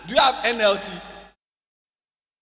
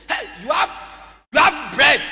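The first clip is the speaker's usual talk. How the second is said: put-on loud, raised call to a boy; acted hailing; 6 semitones higher, 11 dB louder than usual. Andrew spots the boy with bread, acted hailing. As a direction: hail him, pouncing on the bread.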